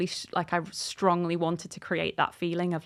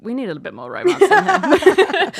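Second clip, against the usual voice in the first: louder and higher, laughing through the editor's demand; mock editor voice; 8 semitones higher, 10 dB louder than usual. Author mimicking her editor asking for romance, laughing mock voice. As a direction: play the pushy editor, half laughing. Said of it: "my editor was basically like".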